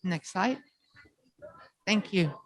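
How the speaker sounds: ordinary delivery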